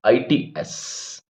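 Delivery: whispered letters आईटीएस, spelled one by one; whispered spelling of आईटीएस. Shown as a demonstration. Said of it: "it is और its में क्या फर्क है".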